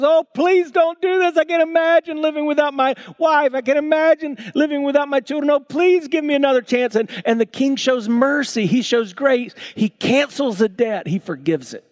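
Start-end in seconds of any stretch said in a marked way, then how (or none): 0.0-7.0 much higher, louder desperate pleading debtor; begging. Acted. Debtor begging the king not to sell his family, high pleading. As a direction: beg the king as if your family depends on it. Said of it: "goes begging to the king".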